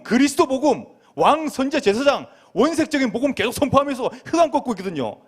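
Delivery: high, forceful preaching in punchy bursts; fervent preaching. Real, not acted. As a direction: proclaim it urgently to the congregation. (힘차게 외치는 설교조)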